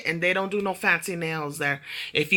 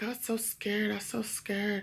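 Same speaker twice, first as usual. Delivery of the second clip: mock scared; 10 dB quieter, slower than usual. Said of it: "so she's like".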